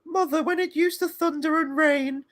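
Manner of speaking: put-on high-pitched pleading child; mock a scared little boy; high whiny child voice. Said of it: "Was it like".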